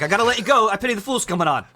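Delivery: high, hurried-sounding mock excitement; acted eagerness to hang up; eager phone excuse. On the phone with girlfriend, mock eager excuse. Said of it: "I'd be on the phone with my girlfriend".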